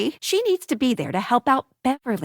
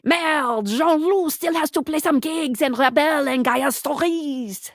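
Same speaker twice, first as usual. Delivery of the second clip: put-on exclamation, then a French-accented game line. Game narrator reporting on Jean-Loup's gigs, French accent.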